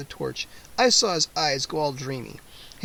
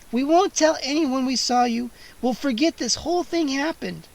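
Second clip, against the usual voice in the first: put-on much higher pleading girl; 8 semitones higher than usual. A narrator's reading. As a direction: bargain with him desperately. Kara pleading.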